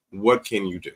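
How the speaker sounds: usual speaking voice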